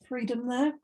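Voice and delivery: usual speaking voice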